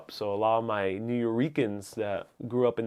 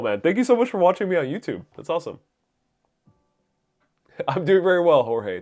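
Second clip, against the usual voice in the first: normal talking voice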